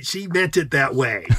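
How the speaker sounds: ordinary talk, nothing marked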